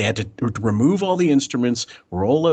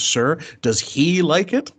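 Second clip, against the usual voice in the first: anxious, slow plea; 6 semitones higher, slower than usual. Retold or imitated. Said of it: "He goes".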